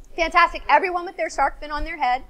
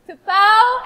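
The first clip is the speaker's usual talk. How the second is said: ordinary delivery, nothing marked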